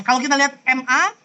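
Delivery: ordinary talk, nothing marked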